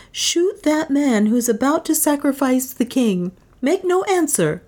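high urgent warning to Leonard; urgent woman. A narrator's reading. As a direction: warn him secretly while facing away.

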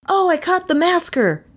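high, bursting with great news; excited boy's cry. A narrator's reading. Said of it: "he cried to them".

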